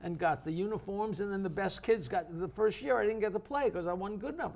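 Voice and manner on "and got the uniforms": usual speaking voice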